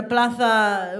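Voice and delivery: "plaza" as PLA-tha, showily Castilian; Spanish pronunciation. Shown as a demonstration. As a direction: show off "plaza" with a Spanish lisped z. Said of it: "I pronounce words in their original accents".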